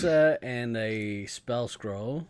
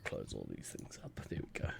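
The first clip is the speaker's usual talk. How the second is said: whispered aside; 19 dB quieter than usual. Real, not acted. Muttering to himself at desk.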